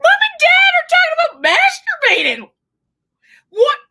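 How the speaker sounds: high, loud shocked yell, laughing; high, loud, hammed-up disbelief; mock appalled kid. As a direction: shriek it like a horrified kid. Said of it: "some kid going".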